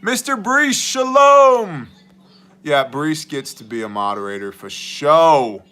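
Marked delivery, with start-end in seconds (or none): 0.0-1.9 high, loud called-out greeting; a big hammed-up welcome shout; calling out greeting. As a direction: hail him across the room.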